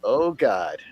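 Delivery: normal talking voice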